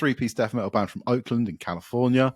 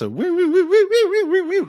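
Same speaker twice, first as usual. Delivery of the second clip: high squealing woo woo like pinch harmonics; imitated guitar squeals; 6 dB louder than usual. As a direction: mimic a screaming guitar lick, very high. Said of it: "that sort of".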